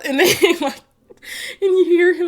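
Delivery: laughing. Real, not acted. Telling viewers about the funny burger video, laughing out loud. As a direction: laugh through the words, can't hold it in.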